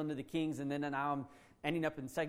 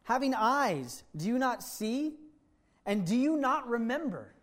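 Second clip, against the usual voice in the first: higher, slow, pointed questions; Jesus rebuking the disciples, slow and higher; 5 semitones higher, slower than usual. Acted. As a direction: press each question home. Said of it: "He says".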